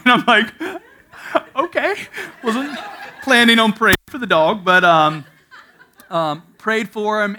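real laughter while telling; laughing. Real, not acted.

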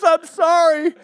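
ordinary delivery

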